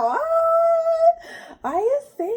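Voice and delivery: high, crying disbelief; shocked. Retold or imitated. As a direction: relive the shock of seeing the number. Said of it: "I'm still in shock".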